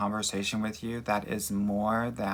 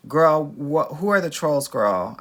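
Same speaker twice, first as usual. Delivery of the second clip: loud, high, indignant call-out; excited shout; 5 semitones higher, 9 dB louder, slower than usual. Real, not acted. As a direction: shout it out, fired up. Calling out trolls on stream.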